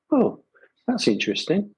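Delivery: high "Oh" of imitated surprise; mock surprise. Retold curious customers asking.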